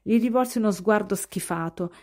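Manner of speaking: plain reading voice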